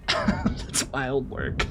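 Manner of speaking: goofy slow repeat; slow drawn-out mock voice. Shown as a demonstration. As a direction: stretch it long and high in a silly put-on voice. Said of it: "repeated slowly, higher".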